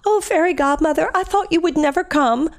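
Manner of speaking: lightly voiced princess, pleading; Cinderella again. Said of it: "Cinderella says to the fairy godmother".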